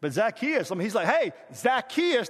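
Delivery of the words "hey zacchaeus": high, slow shout to someone up a tree; acted calling out. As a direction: hail him loudly, drawing out the name. Acts Jesus calling up to Zacchaeus.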